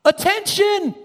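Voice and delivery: high, loud call of attention; booming alarm announcement. Acted. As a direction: ring it out like a siren. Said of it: "He sounds the alarm".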